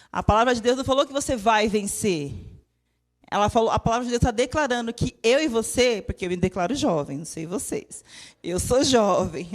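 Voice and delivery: normal talking voice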